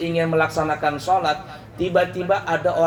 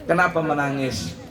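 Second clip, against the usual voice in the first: put-on soothing a child; 3 semitones lower than usual. Prophet soothing the weeping palm stalk like a child. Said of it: "dibisai pelepah".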